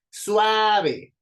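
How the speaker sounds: Suave with SUA high, loud and long; stress on SUA. Shown as a demonstration. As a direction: punch and hold SUA in Suave. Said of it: "Sua suena más fuerte". (Suave con la SUA fuerte, aguda y alargada)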